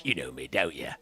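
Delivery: low, gruff Penguin croak. Retold or imitated. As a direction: sneer like a Gotham crime boss. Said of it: "And the Penguin".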